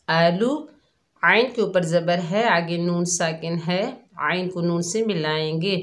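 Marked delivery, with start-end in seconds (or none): none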